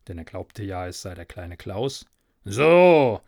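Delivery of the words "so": calling after. A narrator's reading. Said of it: "rief ihm nach".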